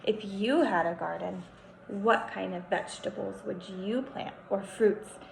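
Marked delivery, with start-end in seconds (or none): none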